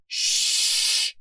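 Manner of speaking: long librarian's "shh"; sharp, drawn-out hush; stern shushing. A narrator's reading. Librarian glaring at the noisy detective, shushing sternly. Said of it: "Mildred the librarian instructed, glaring".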